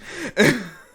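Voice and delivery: high burst of laughter; sudden loud chuckle; short loud laugh. Real, not acted. GM laughs at own line.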